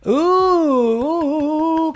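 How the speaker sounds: long, high, loud "oooh"; excited ooh. Real, not acted. Host reacting to Carol's great idea, excited ooh. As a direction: draw out an impressed, rising-then-easing ooh.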